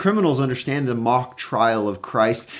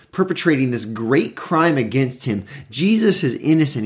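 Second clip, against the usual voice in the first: ordinary talk, nothing marked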